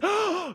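sharp mock gasp; shocked gasp. Husband mimicking wife seeing son's back, shocked gasp. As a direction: gasp in exaggerated horror.

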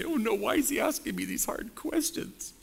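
high, fretful mock panic; mock flustered God. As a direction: play God as nervous, lightly. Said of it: "He's not gonna say".

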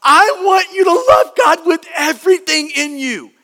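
mock screaming. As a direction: call it out with all-out insistence. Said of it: "he's, like, screaming really loud".

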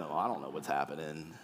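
low, soft, underwhelmed mumble; clueless kid. Acted. Voices his clueless eight-year-old.

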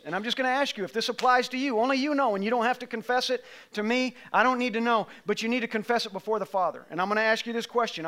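ordinary talk

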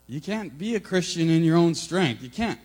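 preaching emphasis. Acted. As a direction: drive the point home.

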